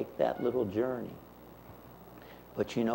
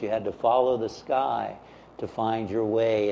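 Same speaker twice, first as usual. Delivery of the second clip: ordinary talk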